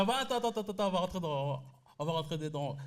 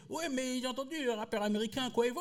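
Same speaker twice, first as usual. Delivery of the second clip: lightly voiced mother, pitched up; imitating his mother; 6 semitones higher than usual. Mother talking about an American rapper as if she knew him, lightly mimicked. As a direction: mimic your mum in a higher voice. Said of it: "Ma mère, elle me parle de [name]".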